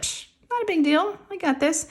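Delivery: high, soft, breezy brush-off; playing it down. Patient psyching herself up before blood draw, playing it down. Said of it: "wasn't making it a big deal".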